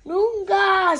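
high, loud drawn-out screech; screeched call. A narrator's reading. Harry demands entry from outside the club, screeching. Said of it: "screeched Harry again".

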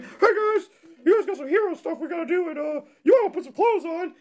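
high, put-on squeaky voice; Hawkeye on phone duty. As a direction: pipe up timidly in falsetto.

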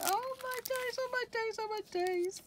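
rising excited oh my days; squealing delight. Real, not acted. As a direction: squeal over the fairies. Spotting fairy stencils.

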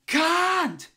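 high strained scream on Can't. Acted. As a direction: shriek Can't, high and crying. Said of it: "You shouldn't scream at me".

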